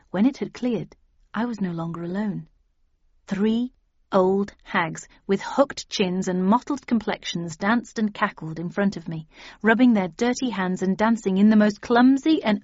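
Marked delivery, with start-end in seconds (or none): none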